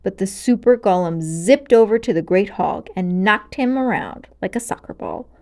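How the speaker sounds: usual speaking voice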